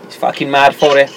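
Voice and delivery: a Manchester accent, badly done; lightly put-on Mancunian lad voice; a weak Manchester accent impression. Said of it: "That was a shit accent".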